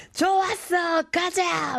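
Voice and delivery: high, hammed-up shout of a drinking table; mock rowdy cheer. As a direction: yell it like a cheering coworker. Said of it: "막 이러면서". (회식 자리 옆 테이블 직장인 흉내, 과장된 외침)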